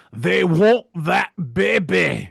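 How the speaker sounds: loud, slow, hammed-up movie-character voice; Fat Bastard. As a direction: bellow it word by word, greedily. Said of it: "I'm getting my fat bastard back".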